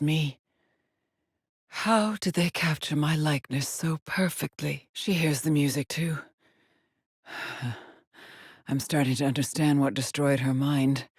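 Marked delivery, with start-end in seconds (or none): none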